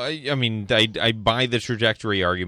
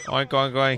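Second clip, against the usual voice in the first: imitated pig oinking; pig oinks. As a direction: oink like a pig. Imitating pigs.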